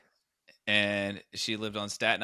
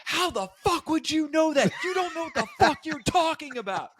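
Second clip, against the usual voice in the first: high furious retort. Retold or imitated. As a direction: snap at her in a high voice. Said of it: "And she goes".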